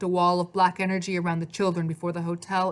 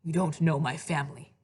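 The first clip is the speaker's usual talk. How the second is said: dark. A narrator's reading. Hades to Maria about his family, dark. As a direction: say it darkly, with restraint. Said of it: "Hades said darkly".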